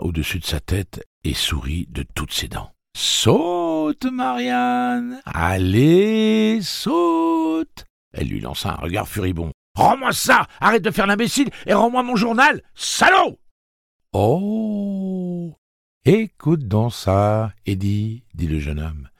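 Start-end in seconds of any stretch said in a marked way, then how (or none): 3.0-7.9 put-on high, taunting sing-song voice of a young bully; mocking teasing boy. A narrator's reading. Teasing older boy taunts girl. 9.7-13.4 high, loud furious demand; angry girl. A narrator's reading. Girl furiously demands diary back. 14.1-18.2 mock offense. A narrator's reading. Bully mocks her outburst.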